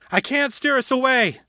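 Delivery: Harold yelling that he can't steer clear; urgent shout. A narrator's reading. Harold shouting to his crew, high and urgent. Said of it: "Harold shouted".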